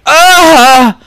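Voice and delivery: loud, high "Ah!"; shocked cry. Acted. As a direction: cry out as if hit by a smell. Acting out shock at smell.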